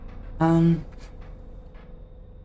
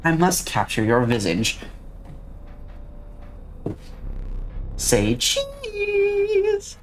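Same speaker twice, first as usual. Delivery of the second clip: high, eerie sing-song cheese; unsettling villain trying to sound sweet; creepy voice; 8 semitones higher, 4 dB quieter, much slower than usual. Acted. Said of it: "Was that creepy? It was supposed to be".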